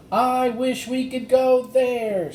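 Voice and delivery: high, slow, loud wish; longing girl. Acted. Annie wishing to go to the Wild West, longing. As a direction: wish it with all your heart.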